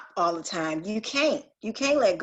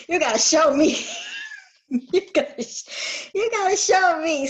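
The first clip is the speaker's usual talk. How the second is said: higher and louder, laughing as she says it; laughing through words; 11 semitones higher, 4 dB louder than usual. Real, not acted. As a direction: laugh out the demand to be shown. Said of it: "I'm one of his hard-headed children".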